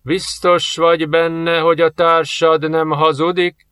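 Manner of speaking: slow, probing; hidden lord questioning. Acted. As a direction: question slowly and suspiciously.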